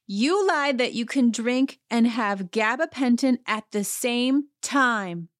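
hammed-up retold accusation; mock accusation. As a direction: count off the charge, stretching the ending. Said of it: "eight point five Ann says".

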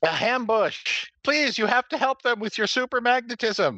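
high pleading damsel voice; damsel in distress. Pretend. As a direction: beg like a melodrama heroine. Damsel pleads to Cosmic Boy.

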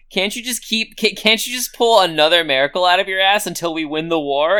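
mock indignant rant; pissed-off complaint. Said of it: "Wong is pissed that they have to stop".

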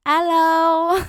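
high, loud mock French-accented "Hello"; switching to English. Host spots tacky tourists, mock French "Hello". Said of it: "when the host sees me and my mom".

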